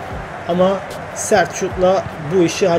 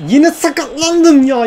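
higher, slow, drawn-out groan of frustration; exasperated whine; 8 semitones higher, 5 dB louder, slower than usual. Real, not acted. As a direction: whine it out slowly. Frustrated at injury again. (bıkkın, uzatarak sızlanma)

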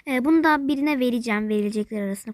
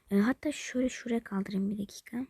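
soft unvoiced whisper; 3 semitones lower, 6 dB quieter than usual. Real, not acted. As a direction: mutter it under your breath. Whispering while arranging.

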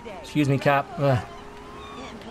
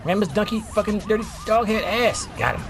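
ordinary talk

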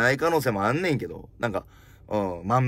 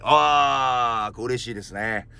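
ordinary delivery

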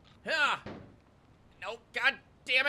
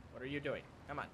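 low, hushed grumble; muttered self-reproach; 9 semitones lower, 14 dB quieter, much faster than usual. Real, not acted. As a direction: grumble it under your breath. Muttering to self while playing.

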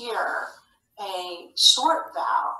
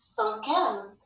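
ordinary delivery

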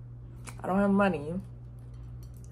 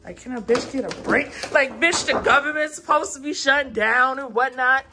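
exasperated rant; 5 semitones higher, 4 dB louder than usual. Real, not acted. Venting frustration, exasperated rant.

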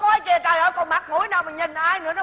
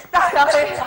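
ordinary talk, nothing marked

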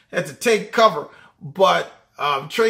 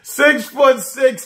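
ordinary talk, nothing marked

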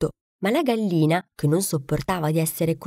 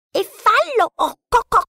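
very high, louder snapping hen; irritated hen; 5 dB louder than usual. A narrator's reading. Annoyed hen dismisses the duckling, high clucks. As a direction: cluck it out in a huff. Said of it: "rispose stizzita".

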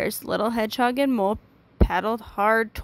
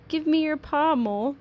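higher, urgent storybook call; mild shout; 6 semitones higher than usual. A narrator's reading. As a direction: call out urgently without much volume. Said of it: "shouted Little Hedgehog".

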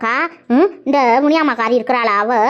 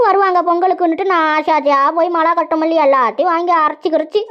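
usual speaking voice

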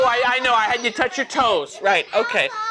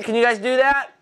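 usual speaking voice

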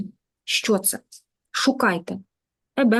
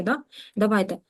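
ordinary talk

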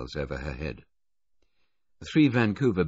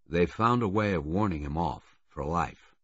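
ordinary delivery, nothing marked

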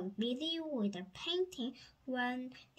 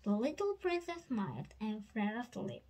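ordinary delivery

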